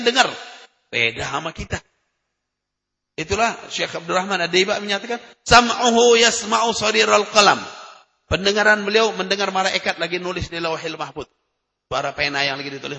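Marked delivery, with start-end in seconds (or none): 5.5-7.6 raised, measured Arabic recitation of a hadith. Acted. Preacher recites the hadith to the congregation, solemn and measured. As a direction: chant the Prophet's words slowly and solemnly.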